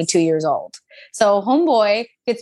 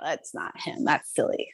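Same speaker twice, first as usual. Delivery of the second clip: voiceless, brushing-it-off whisper; dismissive whisper; 3 semitones lower, 9 dB quieter than usual. Retold or imitated. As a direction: whisper it to yourself, waving it away. Dismissing God's word, retold.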